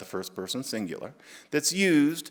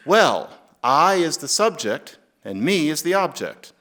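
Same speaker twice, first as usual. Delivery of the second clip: higher, slowed mock pedant voice; 4 semitones higher, 6 dB louder than usual. Mocking a stickler who corrects your grammar, pedantic. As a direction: lecture it like a fussy grammar stickler. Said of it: "you say".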